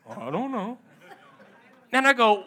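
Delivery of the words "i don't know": soft, lightly voiced clueless husband; confused husband. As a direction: mumble it like a confused puppy dog. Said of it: "like a confused puppy dog".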